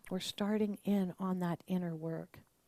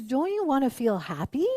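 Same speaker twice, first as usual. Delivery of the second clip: louder, higher, disbelieving push toward happy; incredulous coaxing; 4 semitones higher, 6 dB louder than usual. Retold or imitated. Therapist to adult client, louder incredulous coaxing. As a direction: urge them, amazed they'd settle. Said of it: "She goes".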